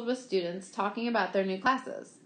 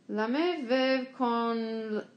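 slow, halting fake French; uncertain bluffing French; 2 semitones higher, much slower than usual. A narrator's reading. Boy bluffing fake French in class, slow and unsure. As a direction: fumble out French-sounding noises, unsure. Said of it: "he said uncertainly".

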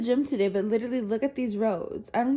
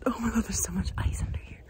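whispering; 6 dB quieter, faster than usual. Real, not acted. Whispering on icy ground.

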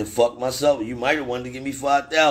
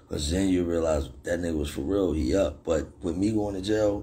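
ordinary talk, nothing marked

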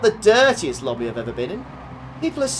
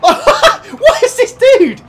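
high, slow, laughing disbelief; incredulous laughing; 8 dB louder, much slower than usual. Real, not acted. Streamer baffled by a glitching driver, laughing disbelief. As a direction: stretch it out in amused disbelief.

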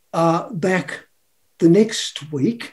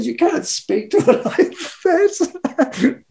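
indignant retort; 8 semitones higher, slower than usual. Retold or imitated. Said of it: "I said".

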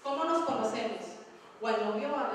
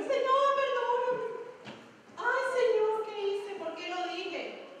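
mock pleading; 8 semitones higher, slower than usual. Preacher mocks a believer who hurt his brother, pleading. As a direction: ham up a guilty believer begging forgiveness. Mocking a careless believer's plea.

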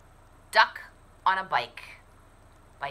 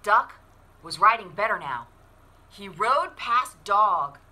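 put-on lively storybook narration for kids; animated picture-book read-aloud; reading to children; 6 semitones higher, 13 dB louder, much slower than usual. A narrator's reading. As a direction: read it like story time at the library.

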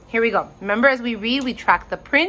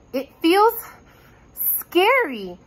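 higher voice, a touch of dread; mildly frightened; 8 semitones higher than usual. A narrator's reading. Bo sensing strange magic in the tunnel, uneasy. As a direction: raise the pitch, pausing uneasily before the last word.

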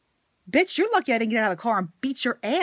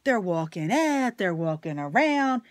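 usual speaking voice

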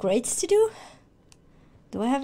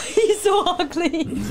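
slow, helpless tearful laughter; crying and laughing. Real, not acted. Player laughing at boss's ugly face, tearful. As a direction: laugh through real tears. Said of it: "I'm crying and laughing because his face".

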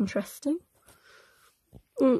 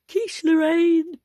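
announcing title; 5 semitones higher, 5 dB louder, slower than usual. Acted. Announcing a book chapter title.

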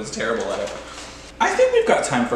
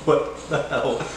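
mock other players; 3 semitones higher, slower than usual. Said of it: "These guys are like".

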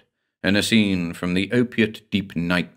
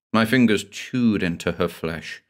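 usual speaking voice

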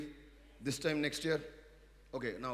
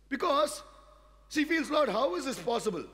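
loud, high plea; preaching cry; 13 semitones higher, 9 dB louder than usual. Acted. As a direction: cry out the doubt. Preaching Sarah's doubt.

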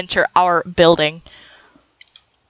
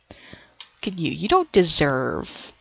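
quiet; 5 semitones lower, 7 dB quieter, slower than usual. Acted. Taunting sim in low voice.

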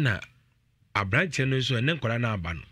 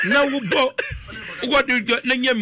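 louder and higher, pressing the warning on parents; heated warning; 12 semitones higher, 5 dB louder than usual. Real, not acted. Host warning parents on air about careless play, loud and insistent. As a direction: raise your voice to drive the point home.

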